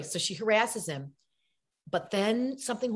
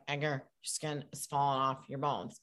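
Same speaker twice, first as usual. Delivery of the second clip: low, toneless imitation of a Syracuse girl, softer; deadpan imitation; 6 semitones lower, 7 dB quieter than usual. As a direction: say it with no expression at all, low and quiet. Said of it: "I had no expression".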